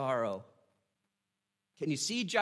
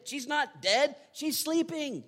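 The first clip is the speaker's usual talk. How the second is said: airy, much higher, unbothered; breezy no big deal; 10 semitones higher, 3 dB louder than usual. Retold or imitated. Pastor voicing Jesus shrugging off death, breezy. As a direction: toss it off like it's nothing. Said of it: "He's fine with it".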